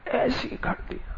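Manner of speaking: breathy whispered repeat, under the breath; whispered aside. Real, not acted. To the audience about the made-up interview, whispered. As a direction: let the words fall away into a whisper.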